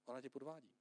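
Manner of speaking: low, hushed insinuating voice; tempter's whisper. Retold or imitated. Pastor voices the whispering tempter, hushed and low. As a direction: murmur it like a voice in your ear. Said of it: "nějaký našeptávač".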